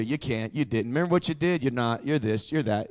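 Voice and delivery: very low, hushed string of accusations; guilt-tripping accuser. Retold or imitated. Accuser heaping guilt on a believer, low insinuating murmur. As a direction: murmur each charge under the breath, as the accuser. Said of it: "And then guilt you".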